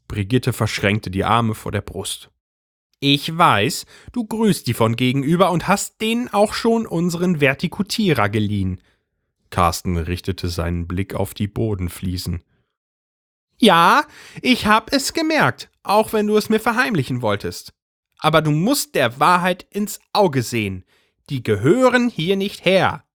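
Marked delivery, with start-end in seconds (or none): 3.0-8.8 high, pointed accusation; pitched-up accusing housewife; accusing wife. A narrator's reading. Brigitte accuses husband. 13.6-23.0 higher, excited gossiping wife; high agitated housewife voice; Brigitte's voice. A narrator's reading. Brigitte lectures husband.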